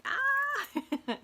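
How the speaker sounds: sudden high loud yelp of panic; mock panic cry; panicked yelp. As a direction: squeal like a frazzled mind. Mimics floating chaotic mind.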